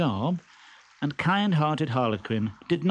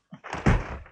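short breathy high chuckle; smug chuckle. Acted. Stentorello chuckling inside the trunk. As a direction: laugh at your own joke.

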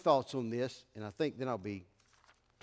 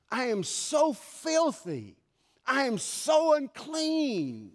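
Isaiah's lament; 8 semitones higher, slower than usual. Acted. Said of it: "what did Isaiah say".

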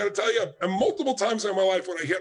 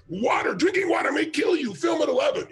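higher, shouted mock headline; mock news teaser; 8 semitones higher than usual. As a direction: hype it like a scary TV promo. Mocking a sensational news headline.

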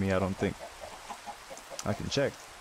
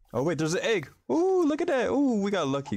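excited; 10 semitones higher than usual. Real, not acted. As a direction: squeal with delight at the find. Finds a dropped egg.

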